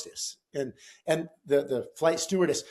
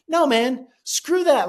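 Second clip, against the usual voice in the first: high, loud burst of indignation; indignant retort; 11 semitones higher, 7 dB louder, slower than usual. Retold or imitated. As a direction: protest it, fed up and raised. Fed up with the blocked seat.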